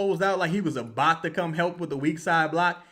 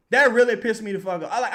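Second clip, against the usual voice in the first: ordinary talk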